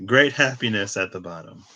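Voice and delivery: usual speaking voice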